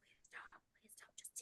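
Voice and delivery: whispered, begging under the breath; pleading whisper. Retold or imitated. Mother pleading with a stiff toddler, in a whisper. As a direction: hush a plea so the kid gives in.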